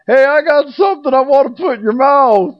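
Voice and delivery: very high, loud hammed-up call across the subway car; mock yelling stranger. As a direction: holler it like a crude guy on the train. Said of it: "he started yelling out to somebody".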